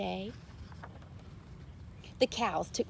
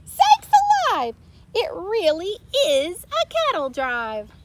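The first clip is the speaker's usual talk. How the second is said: huge high shout, then higher, louder; deputy crying out; 10 semitones higher, 10 dB louder than usual. A narrator's reading. Deputy crying out at the cows driving. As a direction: yell in disbelief. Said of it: "The deputy cried".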